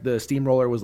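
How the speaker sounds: ordinary delivery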